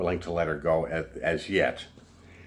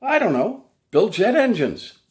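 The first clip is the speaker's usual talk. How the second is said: sarcastic; 13 semitones higher, 5 dB louder than usual. Retold or imitated. Sarcastic retort to her.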